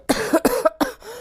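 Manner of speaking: a rough sick cough. Acted. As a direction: cough weakly. Sick Sunset.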